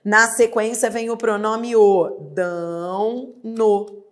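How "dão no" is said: "Dão no" very slow and soft; slow demo. Shown as a demonstration. Teacher gives the answer form, very slow. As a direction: stretch "Dão no" gently. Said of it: "Acrescenta-se N".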